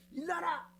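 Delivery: very high, short Gyeongsang order; Gyeongsang dialect. Shown as a demonstration. Preacher repeating the quick Gyeongsang 'get up', commanding. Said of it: "경상도 말이 좀 단순하고 빠른 것 같아".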